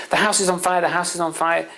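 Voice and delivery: high-pitched retold alarm; panicking father. Father tells son of the fire, acted panic. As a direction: imitate frantic, repeated alarm. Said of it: "the father's panicking and panicking and panicking".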